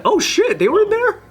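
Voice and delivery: high startled voice; surprised. Pretend. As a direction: gasp it out in shock.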